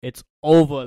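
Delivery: loud call, imitating a sound drop; acted-out sound effect. Panelist mimicking Kenny Smith's catchphrase, acted-out sound effect. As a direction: punch it out loud like a commentator's catchphrase. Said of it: "act out the sound effects".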